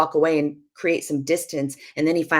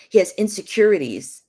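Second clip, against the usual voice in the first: normal talking voice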